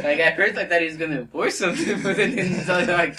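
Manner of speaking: loud laughing narration. Real, not acted. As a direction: tell it while cracking up.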